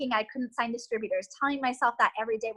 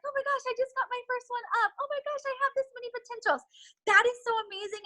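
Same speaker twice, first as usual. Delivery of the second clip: much higher, squealing, rushing the second line; imitating giddy recruit; 11 semitones higher, faster than usual. Coach voicing a recruit shouting out first post, high giddy squeal. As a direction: gush like a kid with good news. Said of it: "Be like".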